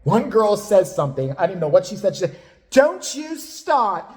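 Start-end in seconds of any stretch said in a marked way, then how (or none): 2.7-4.0 high, drawn-out imitated yell; mock screaming. Kim going over to a girl's bed, high imitated yelling. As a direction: stretch out a shrieked mock warning. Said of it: "starts screaming at this girl".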